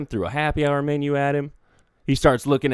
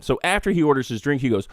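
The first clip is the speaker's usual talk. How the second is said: plain reading voice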